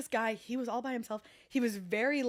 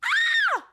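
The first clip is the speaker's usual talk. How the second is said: shriek; 10 dB louder than usual. Real, not acted. Shrieks at the swear.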